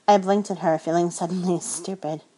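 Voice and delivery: ordinary delivery, nothing marked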